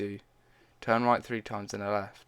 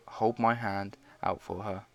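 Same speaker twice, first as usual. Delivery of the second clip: usual speaking voice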